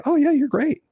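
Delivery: high chirpy mock praise; mock flattery. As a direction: toss off a fake compliment, way up high. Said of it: "Most people would say".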